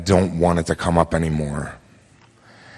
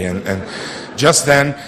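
usual speaking voice

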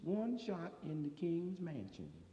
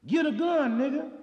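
loud quote; 9 semitones higher, 10 dB louder than usual. Retold or imitated. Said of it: "Rap Brown say".

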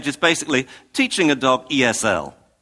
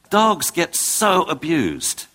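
normal talking voice

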